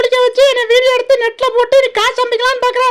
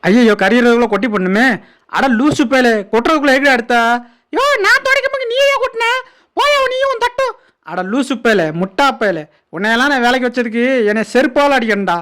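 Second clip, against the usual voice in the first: deep gruff animal voice; 12 semitones lower than usual. Acted. Voiced animal scolding helper.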